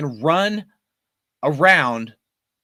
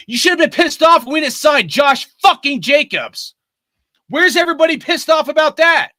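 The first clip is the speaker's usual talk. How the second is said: shouting at the fans, much higher and louder; fired-up rant; 8 semitones higher, 7 dB louder than usual. Real, not acted. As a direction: yell it out in real outrage. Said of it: "So, yeah, I'm fired up".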